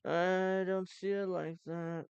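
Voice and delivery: slowed, heavy politician cadence; mock Al Gore, dragging every word; slow politician drawl. Shown as a demonstration. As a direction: stretch it out like a tired politician. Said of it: "They talk like this".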